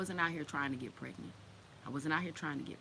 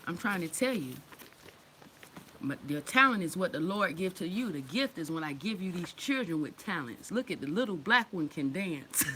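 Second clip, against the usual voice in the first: ordinary delivery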